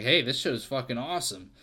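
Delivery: ordinary talk, nothing marked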